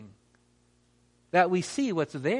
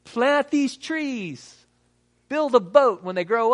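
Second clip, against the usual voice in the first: higher, very slow offhand instruction; lightly voiced rescuer; 6 semitones higher, slower than usual. Helicopter rescuer instructing the castaway, lightly voiced. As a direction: hand it down casually, like nothing's wrong. Rescuer drops acorns, gives orders.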